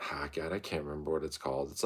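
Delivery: ordinary delivery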